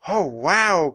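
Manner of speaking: loud, swooping amazed exclamation; cry of wonder. A narrator's reading. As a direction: cry out in delight at the view. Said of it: "cried Annie".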